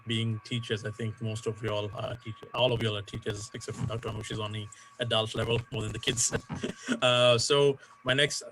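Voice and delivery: usual speaking voice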